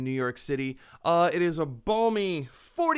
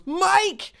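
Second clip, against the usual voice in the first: imitated shout of Mike, higher and louder; calling out; 13 semitones higher, 7 dB louder than usual. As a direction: yell Mike across the apartment. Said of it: "runs out of the office and she goes".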